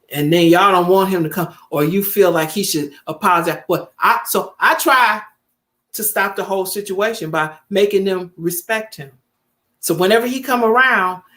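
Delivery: ordinary talk, nothing marked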